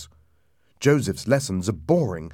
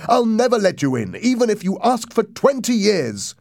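defiant, high, loud shouting; 11 semitones higher, 5 dB louder than usual. A narrator's reading. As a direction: shout it in panicked refusal. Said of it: "I shouted".

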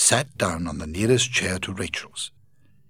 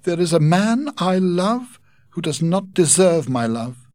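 heartbroken Rachel; 9 semitones higher, 4 dB louder than usual. Acted. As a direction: beg him to leave you alone. Rachel confesses hopeless love.

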